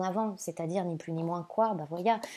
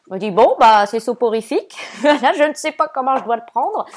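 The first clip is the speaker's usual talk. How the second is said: playfully indignant, a bit louder, ending in a laugh; mock offended joke; 4 semitones higher, 12 dB louder than usual. Joking to viewers who fall asleep listening, mock offended. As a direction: tease yourself as if hurt by the compliment. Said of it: "Non, non, je, je plaisante".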